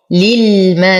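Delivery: للملائكة with the aa held six counts; long madd. Shown as a demonstration. Teacher reciting Warsh style, للملائكة with long held madd. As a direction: stretch the aa of للملائكة before the hamza. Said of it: "يمد بالإشباع لورش بمقدار ست حركات".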